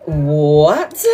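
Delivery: low, drawn-out mock "what"; best mate's disbelief. Retelling best mate's reaction when told, mock disbelief. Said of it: "and she was like".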